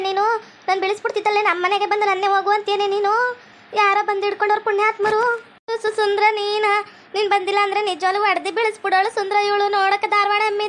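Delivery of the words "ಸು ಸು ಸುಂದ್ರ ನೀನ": usual speaking voice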